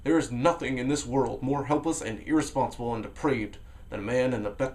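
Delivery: ordinary delivery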